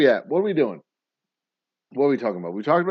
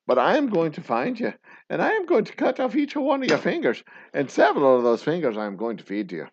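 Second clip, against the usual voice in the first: hammed-up Irish menace, louder at first; bad Irish accent; 2 semitones higher, 5 dB louder than usual. Father with a set of skills threatens a kidnapper, bad Irish accent. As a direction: spell out the threat in a mock-Irish brogue. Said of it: "affect a bad Irish accent".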